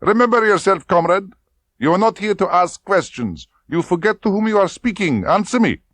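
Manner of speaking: high, loud, cutting scold; whip-crack rebuke. Acted. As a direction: lash her back into line. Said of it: "her voice cut back like a whip".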